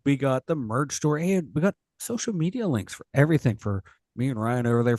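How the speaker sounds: ordinary delivery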